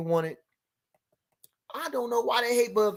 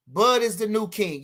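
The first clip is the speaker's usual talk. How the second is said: usual speaking voice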